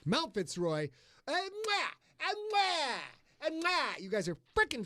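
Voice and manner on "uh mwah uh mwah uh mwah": high smacking kiss sounds; blown kisses. Pretend. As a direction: blow kisses to the crowd. Blowing kisses to listeners.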